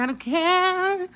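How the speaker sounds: high, stretched-out singsong insistence; long high wail of not caring; drawn-out defiance. Real, not acted. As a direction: pitch it way up and hold the last word.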